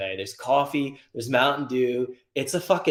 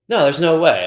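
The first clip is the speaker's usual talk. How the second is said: loud flat denial, a little higher; 5 semitones higher, 10 dB louder than usual. Retold or imitated. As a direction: insist there's no way, loudly. Customer denies fire, retold.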